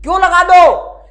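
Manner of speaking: high, loud demanding question; loud challenge. Acted. Teacher demanding the student's reason, loud challenge. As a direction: fire the question back loudly.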